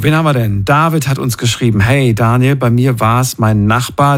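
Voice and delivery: ordinary talk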